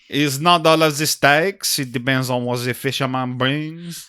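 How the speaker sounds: put-on French accent. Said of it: "very thick French accent".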